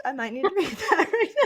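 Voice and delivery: laughing through the words, pitch jumping up; laughing at her own dark joke. Real, not acted. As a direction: giggle out the line at your own joke.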